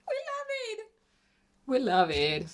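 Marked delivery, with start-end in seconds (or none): 0.1-0.8 much higher, softer gushing; real happy high coo; high quiet squeal of delight. Real, not acted. Admires finished makeup.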